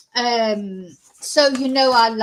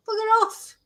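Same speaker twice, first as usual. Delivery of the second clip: bugger off; 13 semitones higher than usual. Retold or imitated. Annoyed at pushy saleswoman.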